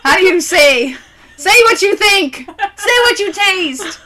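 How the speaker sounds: loud high snooty taster voice; mock wine critic. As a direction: play a pompous beer judge. Mock pretentious beer snob question.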